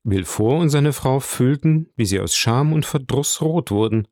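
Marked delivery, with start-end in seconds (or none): none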